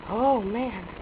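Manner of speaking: ordinary talk, nothing marked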